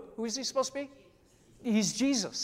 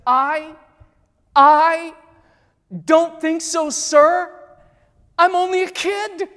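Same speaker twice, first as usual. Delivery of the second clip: halting, higher and loud, broken by pauses; a hint of a frightened boy stammering; 8 semitones higher, 11 dB louder than usual. Pastor voices young Caspian stammering before Aslan. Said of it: "He stammers".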